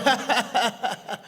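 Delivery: high, staccato acted laugh; imitated mocking laugh. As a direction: mimic the other guy's repeated ha-ha. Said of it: "he just started going".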